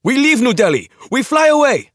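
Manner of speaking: high, full-throated scream of command; terrified young hijacker screaming orders. Acted. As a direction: scream it at the sleeping crew. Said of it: "he screamed at them".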